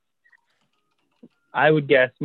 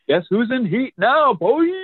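high, teasing she-wolf call; sultry wolf call; 13 semitones higher, 4 dB louder than usual. Acted. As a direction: tease the pack like a wolf in heat. GM voices howling she-wolf.

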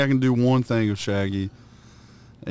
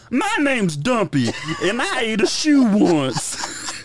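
very high, slow, shouted goofy voice; Dumpy voice; 12 semitones higher, slower than usual. Retold or imitated. Friend imitating Dumpy in the car, high slow shout. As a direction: squeal it out high and slow like a dim cartoon guy. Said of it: "this is what it sounds like".

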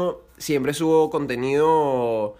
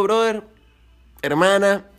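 ordinary talk, nothing marked

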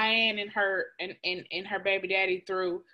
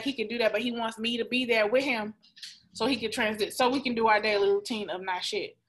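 ordinary talk

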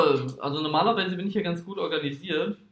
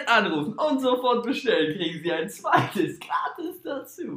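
enthusiastic infomercial announcer; teleshopping voice; 4 semitones higher, 4 dB louder than usual. Pretend. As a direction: sell it like a TV shopping host. Parodied teleshopping ad. (Teleshopping-Sprecher)

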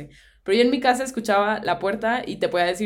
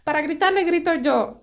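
mom scolding; 8 semitones higher than usual. Retold or imitated. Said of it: "mi mamá me decía".

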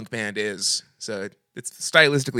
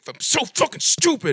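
high, mock self-scolding whine; hammed-up self-scolding squeal; self-scolding guy; 14 semitones higher, 4 dB louder than usual. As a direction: squeal it like a guy kicking himself. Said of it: "and he's like".

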